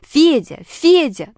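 high, loud name-calling; straining shout. A narrator's reading. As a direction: call desperately for a missing friend. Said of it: "надрывалась буква".

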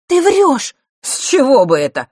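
high, loud accusation and retort; sudden anger. A narrator's reading. Accusation and angry retort over the key's curse, loud. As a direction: flare up all at once. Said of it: "вдруг разозлился Марк".

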